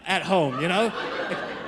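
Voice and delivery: ordinary talk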